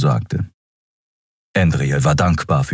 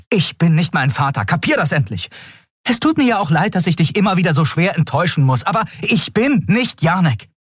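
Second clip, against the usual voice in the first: high-pitched, heated retort; exasperated outburst; 13 semitones higher than usual. Acted. Captain snapping back at being compared to her father, high and exasperated. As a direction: flare up in a much higher voice.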